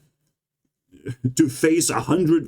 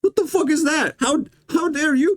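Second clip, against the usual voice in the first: much higher, squeaky mock voice; cartoon chipmunk outrage, hammed-up; 13 semitones higher than usual. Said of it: "this little chipmunk says".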